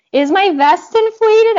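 high, loud and slow, retelling the panic; panicked question. Retold or imitated. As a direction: blurt it out in alarm, face in the dirt. Face down after fall, panicking.

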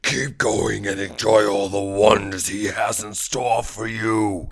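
slow, loud dramatic warning; slow, ominous announcer read. Acted. Spooky podcast intro warning.

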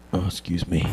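very soft, low, unsure aside; acting too unconfident to speak up; timid mumble. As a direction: say it meekly, barely audible. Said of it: "I don't have the confidence".

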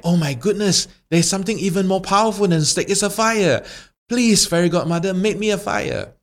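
high, frantic little voice; panicked stick. Acted. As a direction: beg the fairy in panic.

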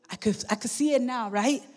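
ordinary delivery

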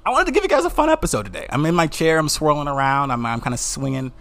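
real excitement, bursting out high and loud at first; excited. Real, not acted. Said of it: "'cause I'm excited about this episode".

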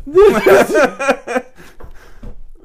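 big loud burst of laughter. Real, not acted. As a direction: crack up loudly. Cracking up at own line.

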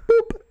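high-pitched playful "boop"; teasing boop. Retold or imitated. Boyfriend teasing her whenever she's crabby, high playful boop. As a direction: squeak out a little nose poke. Acts out teasing nose poke again.

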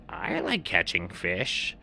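slow heron voice; a simple, slow bird; blue heron. A narrator's reading. Heron agreeing with the seal, slow. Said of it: "And the blue heron said".

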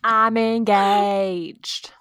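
diva drawl. Acted. Echoing the [name]-style announcement.

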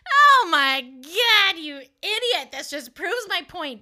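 loud, high, drawn-out "Oh my God"; exasperated outburst. Retold or imitated. Woman calling her date an idiot, loudly exasperated. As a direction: explode in scornful disbelief.

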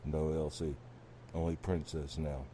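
very low, soft talking cow; cow answering. Acted. Cow refusing the owner's call, low and soft. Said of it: "Cow's like".

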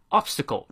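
"Obstacle" stressed on OB, the rest light; "Obstacle" with the weight on the front; stress on first syllable. Shown as a demonstration. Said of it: "重音在前".

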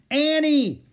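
loud, high yell of a name; calling out. A narrator's reading. Jack calls after Annie in the rainforest, calling out. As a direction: shout after someone running off.

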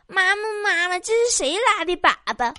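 high-pitched put-on child voice; little son. Son asking his mother at the tofu stall, high child voice. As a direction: pipe up like a curious small boy. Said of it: "他儿子问".

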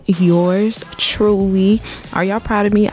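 ordinary talk, nothing marked

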